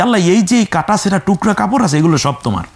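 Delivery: hammed-up worshipper's mistaken prayer; mock prayer. Teacher mimics a worshipper unknowingly mocking God, quick mock prayer. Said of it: "আপনি ঠাট্টা করছেন যে".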